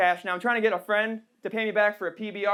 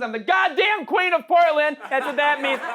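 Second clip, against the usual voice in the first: triumphant shout; 4 semitones higher, 4 dB louder than usual. Real, not acted. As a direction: belt it out, higher and louder.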